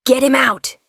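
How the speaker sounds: loud urgent order; shout. A narrator's reading. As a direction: yell at them to act now. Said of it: "Elias shouted".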